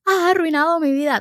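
high, loud tantrum wail; hammed-up accusing yell; mock screaming. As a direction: imitate a child screaming in a tantrum. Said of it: "Y ella gritando".

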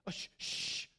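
urgent hushed shushing; whispered shushing. Retold or imitated. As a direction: hush him in a whisper. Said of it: "Peter's like".

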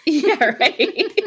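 laughing through it. Real, not acted. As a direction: laugh out the words. Laughing at the joke.

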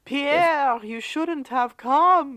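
slow, high, hammed-up movie line; milk it like a melodrama villain's warning; mock dramatic.

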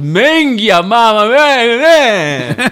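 high, loud mock Italian-accent lament. Caddie imitating golfer's bad-shot cursing, loud Italian-accent wail. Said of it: "saying it in an Italian accent".

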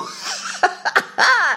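high breathy laugh; a real laugh bursting out; burst of laughter. Real, not acted. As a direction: laugh it off, delighted. Laughing at illegal trucks.

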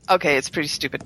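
usual speaking voice

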